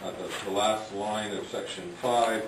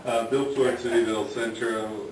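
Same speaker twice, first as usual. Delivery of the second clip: louder, clearer restart into the mic; 3 semitones higher, 7 dB louder than usual. Real, not acted. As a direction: speak up and say it again clearly. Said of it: "Otherwise, you sound like a midnight D J".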